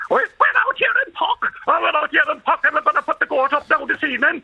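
put-on high lilting Kerry country accent; Puck accent. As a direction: pitch it high and sing-song like a Puck local. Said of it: "And say".

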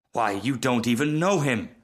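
ordinary delivery

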